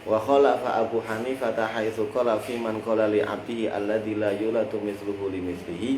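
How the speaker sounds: usual speaking voice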